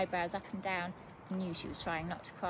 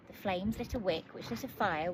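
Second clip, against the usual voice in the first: plain reading voice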